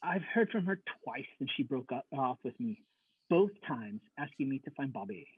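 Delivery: ordinary talk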